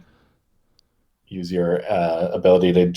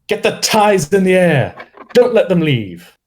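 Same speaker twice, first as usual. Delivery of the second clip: a posh British officer barking orders, much higher and louder; posh British shout; 10 semitones higher, 5 dB louder, slower than usual. Acted. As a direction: shout it like an upper-class British commander. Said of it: "shouting, um, in a posh British voice".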